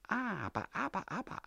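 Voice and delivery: high, sing-song scolding "aber, aber"; a mocking landlady wagging her finger; sly old woman's high tut-tut; teasing old woman. Frau Steindl wags finger, teasing.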